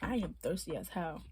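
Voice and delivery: normal talking voice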